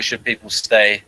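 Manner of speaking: usual speaking voice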